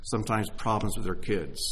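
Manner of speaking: usual speaking voice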